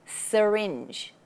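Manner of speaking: "Syringe" with a lazy schwa after the s; relaxed first vowel. Shown as a demonstration. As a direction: say "Syringe" as suh-ringe. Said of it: "the s".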